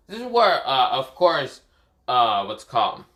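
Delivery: ordinary delivery, nothing marked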